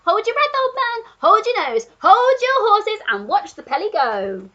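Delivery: high, loud excited monkey; monkey hollering at the old Duke; Monkey shouting. A narrator's reading. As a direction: cheer it out like a gleeful monkey. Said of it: "shouted the Monkey".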